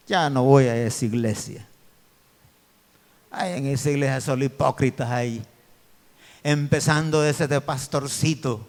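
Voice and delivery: a hint of a grumbling churchgoer; mimicking people who badmouth the church; mocking churchgoer gossip. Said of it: "así dice mucha gente".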